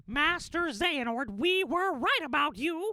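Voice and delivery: high, slow, drawn-out cartoon mouse voice; Mickey Mouse. Fan recites a favourite game line, high mouse voice. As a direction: deliver the heroic line slowly in a high squeak. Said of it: "where Mickey goes".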